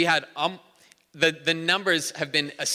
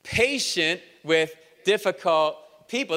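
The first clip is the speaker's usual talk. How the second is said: slow, drawn-out congregational reading; slow, deliberate unison line; slow emphatic recital; slower than usual. Shown as a demonstration. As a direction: stretch each word out with weight. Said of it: "say this with me".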